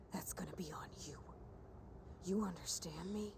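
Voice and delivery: low unvoiced threat; menacing whisper. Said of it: "Was that too much?".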